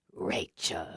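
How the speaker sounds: a beast grinding out one name; icy fury. A narrator's reading. Eric transforming, calling Rachel in low icy fury. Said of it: "mindless, icy fury".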